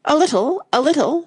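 high-pitched, mildly nervous king. A narrator's reading. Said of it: "the king replied rather nervously".

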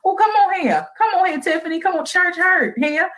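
high, lively church call; excited call-out. Real, not acted. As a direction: cheer her on like in church. Excited affirming outburst.

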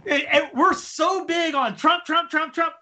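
exasperated, high-pitched harping on one word; getting mad. Real, not acted. Panelist scolding Trump-obsessed hosts, getting mad. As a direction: let frustration push the pitch up, hammering the repeats. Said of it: "I'm getting mad".

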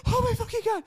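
very high-pitched mock exasperation; exasperated retort. Retelling the coworker's reaction, in high mock exasperation. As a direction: squeal it in comic disbelief.

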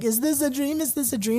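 put-on playful child voice with a rising question; imitating the little boy. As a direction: ask it like a cute kid pinching his cheeks. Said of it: "pinch his cheeks and be like".

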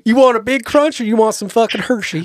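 high, loud hammed-up Southern voice; mock Texan host. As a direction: bellow snacks like a Texas host.

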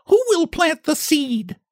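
a busy little hen calls to the idle animals; little red hen calling. Acted. Little hen asking the farmyard for help, high calling voice. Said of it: "she called loudly".

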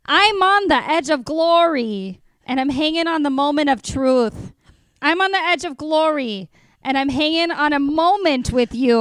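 dramatic reading in broad Minnesota vowels; Midwest mom accent. Acted. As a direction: recite pop lyrics as an earnest Midwest mom, punching phrases. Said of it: "the Midwest mom".

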